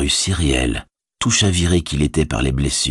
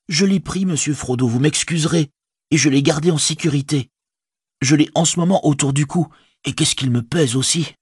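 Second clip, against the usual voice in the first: high-pitched, eager hobbit servant; reassuring Sam; 10 semitones higher than usual. A narrator's reading. Servant revealing he saved the ring, high and earnest. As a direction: contradict his despair in a high, earnest voice.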